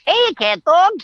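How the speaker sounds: bright cartoon shout of the show's name; Winslow cartoon voice. Shown as a demonstration. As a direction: pop into the Winslow voice, greeting the show by name. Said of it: "I played Winslow".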